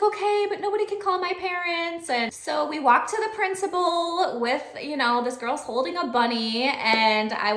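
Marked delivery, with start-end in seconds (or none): 0.0-2.1 higher, anxious plea; worried student. Retold or imitated. Student agreeing but fearing her parents will be called. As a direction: insist nervously like a kid. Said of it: "And she's like".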